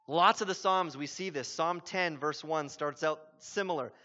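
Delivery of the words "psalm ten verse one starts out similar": ordinary talk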